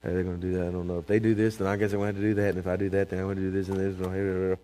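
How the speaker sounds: a hint of anxious muttering; worried mumble. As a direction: mimic a nervous worrier. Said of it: "You walk around saying".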